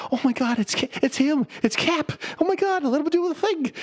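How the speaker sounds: fanboy squeal. Retold or imitated. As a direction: gush like a starstruck fan. Deadpool fanboys over Cap.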